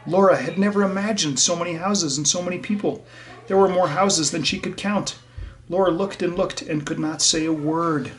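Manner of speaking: put-on lively read-aloud for young listeners; bright, gentle storyteller voice; story reading. A narrator's reading. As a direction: read it to the class like a picture book. Reads storybook to children.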